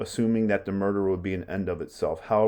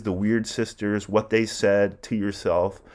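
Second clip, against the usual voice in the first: normal talking voice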